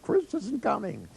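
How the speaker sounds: high, slow fretful whine; mock the nervous worry; a hammed-up high anxious voice; worried Ronnie. Retold quote of worried Ronnie.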